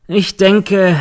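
high, loud sarcastic lawyer. A narrator's reading. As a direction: needle the witness sarcastically. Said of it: "meint der Verteidiger sarkastisch".

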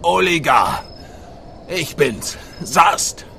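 calling out. Acted. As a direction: call out to a friend who is hiding. Ruft Oligar in der Kapelle. (laut rufend)

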